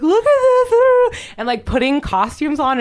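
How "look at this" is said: high, loud, slow squealing mock exclamation; mock amateur ghost hunter. Ghost hunters showing off in Salem, hammed-up high squeal. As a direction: ham it up like a giddy amateur investigator.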